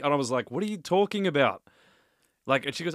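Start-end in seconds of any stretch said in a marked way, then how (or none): 0.5-1.6 higher-pitched retold impatience; mildly exasperated retelling; mild exasperation. Retells snapping at his mum.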